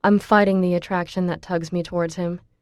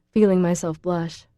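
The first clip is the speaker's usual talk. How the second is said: ordinary delivery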